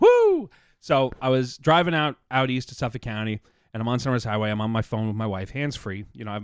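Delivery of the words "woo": high, loud celebratory woo; excited whoop. Real, not acted. Celebrating buying some ammo, an excited whoop. Cheers after ammo purchase news.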